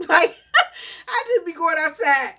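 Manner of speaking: high, loud laugh running into words; laughing at her own escape outside. Real, not acted. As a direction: talk through a real laugh. Laughing at herself.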